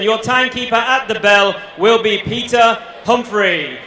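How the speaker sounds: ordinary delivery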